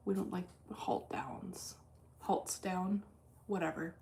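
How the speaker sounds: slow, breathy whispered aside. Real, not acted. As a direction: whisper it to yourself, annoyed. Aside about trading halts.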